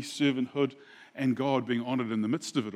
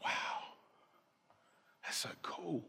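awed whisper; 16 dB quieter, slower than usual. Retold or imitated. David amazed at the water.

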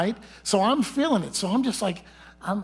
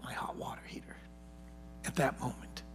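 whispered aside; 5 semitones lower, 14 dB quieter than usual. Real, not acted. Sermon aside, hushed confiding point.

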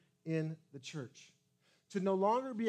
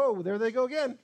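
belittling; 3 semitones higher, 7 dB louder than usual. Retold or imitated. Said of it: "look at somebody else and go".

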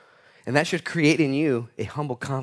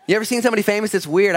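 ordinary talk, nothing marked

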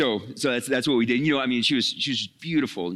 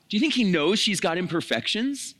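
usual speaking voice

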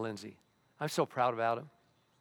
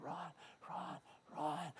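soft calling voice; 2 semitones higher, 14 dB quieter, slower than usual. Acted. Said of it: "This voice is still going".

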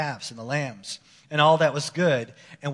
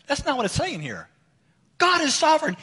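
normal talking voice

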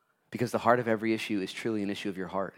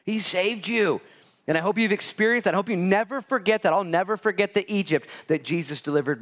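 loud, high preaching at full force; fervent pulpit preaching; 6 semitones higher, 5 dB louder than usual. Acted. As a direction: preach it out over the crowd.

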